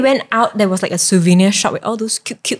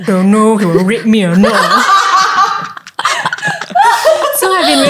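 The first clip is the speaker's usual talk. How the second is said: laughing; 5 semitones higher, 4 dB louder than usual. Retold or imitated. Retelling her fear, laughing.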